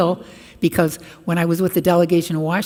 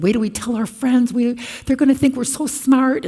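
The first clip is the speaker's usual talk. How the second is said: put-on excited kids; 8 semitones higher, 4 dB louder than usual.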